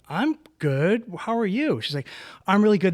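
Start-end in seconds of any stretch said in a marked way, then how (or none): 0.1-1.8 high, sing-song mock girly greeting; mock-sweet squeal of recognition; gushing greeting. Mimicking waitress's fake-sweet reply. 2.5-2.9 bubbly brag. Retold or imitated. Mimicking her bubbly brag.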